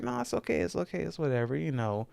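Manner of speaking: high-pitched reassurance. Retold or imitated. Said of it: "So I was like".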